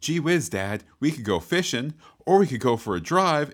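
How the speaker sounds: put-on high, eager little boy voice; wholesome 1950s kid. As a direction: sing it out like a sitcom son. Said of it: "It's like".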